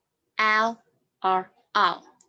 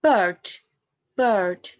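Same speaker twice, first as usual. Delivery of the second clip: "bird" with the R clearly sounded; rhotic pronunciation; 6 dB louder than usual. Shown as a demonstration. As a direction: say "bird" with a strong R. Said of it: "In the erotic accent, we use".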